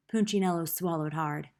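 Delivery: plain reading voice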